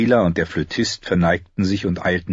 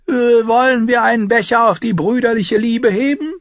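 high, loud, jolly proposal; Tyrion, merry; 5 dB louder than usual. Acted. Tyrion proposing a toast to brotherly love, merry.

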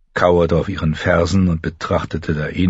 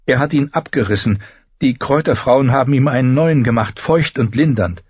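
higher-pitched voice, explaining; raised-pitch character voice, defensive; young queen Dani; 8 semitones higher, 4 dB louder than usual. A narrator's reading. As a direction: voice Dani admitting what was done.

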